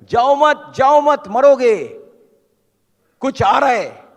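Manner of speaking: acted high, loud warning shout; imitating a local yelling from behind. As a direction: call urgently to people walking into danger. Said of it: "वो पीछे से बोल रहे".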